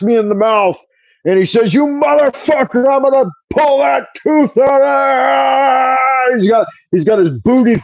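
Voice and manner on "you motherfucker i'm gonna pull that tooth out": high, slow, strained furious yell from the dentist; angry dentist yelling. Retold or imitated. Dentist yelling at his patient mid-extraction, high strained yell. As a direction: bellow it like a dentist losing his grip on the tooth.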